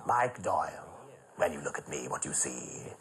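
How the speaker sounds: low, softening theatrical grande dame; Danny La Rue. Retold or imitated. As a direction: purr it like an old stage star.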